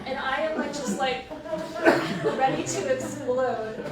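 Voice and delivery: barely holding the laugh in; suppressed laughter. Real, not acted. Retelling the ride prank, fighting back real laughter.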